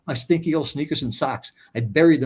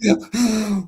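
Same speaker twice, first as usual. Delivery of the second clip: shocked echo; 8 semitones higher, 4 dB louder than usual. Real, not acted.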